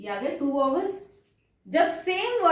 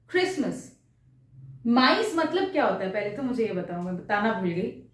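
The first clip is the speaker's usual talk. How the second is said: ordinary delivery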